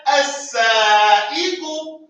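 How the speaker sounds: as-sa'iqu stretched syllable by syllable; slow model word. Shown as a demonstration. Teacher models the word for the class to repeat. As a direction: draw out as-sa'iqu very slowly. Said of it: "Everybody after me".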